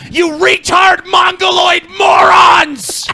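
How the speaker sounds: high, slow, drawn-out furious shout. Real, not acted. Yelling insults at his commenters.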